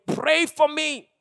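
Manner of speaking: high, drawn-out imitated plea; retold wail for prayer; child crying out. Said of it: "an eight-year-old crying out saying".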